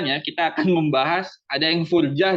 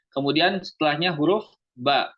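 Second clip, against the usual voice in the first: usual speaking voice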